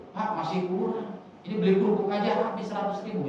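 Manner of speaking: a hint of a wife grumbling about money; complaining wife. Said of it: "ngomongnya begitu". (sedikit meniru istri yang menggerutu)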